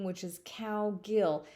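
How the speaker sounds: "Cowgill" slow, /gɪl/ with no r sound. Shown as a demonstration. Counselor correcting cowgirl, slow careful Cowgill. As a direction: say Cowgill slowly, drop the r. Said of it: "letter R in cowgirl is not".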